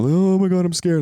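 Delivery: high, loud mock fright; exaggerated scared outburst; mock nervous panic. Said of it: "nervous and not caring".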